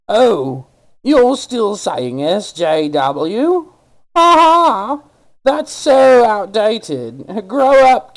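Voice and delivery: high, loud, slow sneering mimicry; mocking smug commenter. Mocking a commenter who scoffs at him online. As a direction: ham up a smug internet troll, laughing at him. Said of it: "had said something to the tune of".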